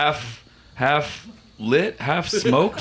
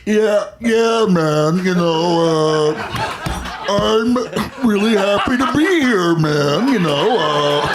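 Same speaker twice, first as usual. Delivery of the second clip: jaw shifted sideways, easygoing dude; Jeff Bridges; 7 semitones higher, 4 dB louder than usual. Retold or imitated. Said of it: "I started doing Jeff Bridges recently".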